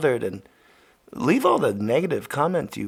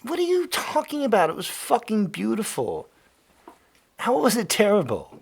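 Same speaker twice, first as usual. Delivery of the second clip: incredulous; 7 semitones higher than usual. Real, not acted. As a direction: challenge him in disbelief. Arguing with cohost about rain.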